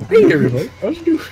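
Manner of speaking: high Kermit the Frog voice. Retold or imitated. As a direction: chirp it like Kermit. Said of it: "We just all become Kermit".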